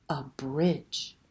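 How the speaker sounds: ordinary delivery, nothing marked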